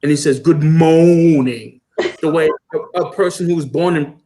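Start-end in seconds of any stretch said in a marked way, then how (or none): 0.4-1.8 slow, louder, badly faked French accent from a British spy. Imitating a British spy in a sitcom mangling a French accent. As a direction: stretch it out in a clumsy French accent. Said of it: "British spy that tries to speak French and he can't".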